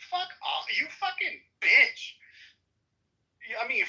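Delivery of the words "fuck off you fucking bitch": high furious yelling; angry shouting. Real, not acted. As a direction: snap back in real rage. Heated on-air argument, cursing.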